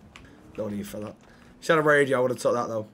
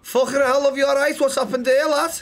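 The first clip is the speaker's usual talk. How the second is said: higher-pitched put-on Scouse; Scouse accent; 5 semitones higher than usual. Passer-by finds an injured woman, in Scouse accent. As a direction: play a startled Liverpool local in Scouse. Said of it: "Scouse, okay".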